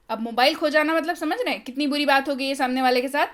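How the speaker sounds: ordinary delivery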